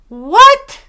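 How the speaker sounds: high, loud disbelief; incredulous. Real, not acted. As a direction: shriek it in disbelief. Incredulous reaction to plot.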